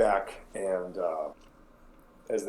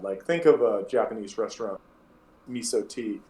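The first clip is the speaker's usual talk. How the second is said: ordinary talk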